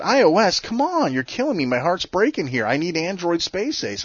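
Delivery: high, whiny mock plea; mock pleading. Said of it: "So I wrote him, I said".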